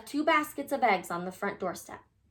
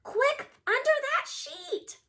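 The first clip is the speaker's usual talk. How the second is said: shout; slower than usual. Acted. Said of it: "shouted the four bunnies".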